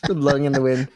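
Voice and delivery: ordinary talk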